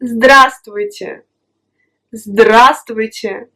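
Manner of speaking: здравствуйте said slowly and clearly, every consonant sounded; здравствуйте stretched out for the learner to copy; slow model word. Shown as a demonstration. A teacher models здравствуйте slowly, then asks the student to repeat. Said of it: "Vas-y, répète".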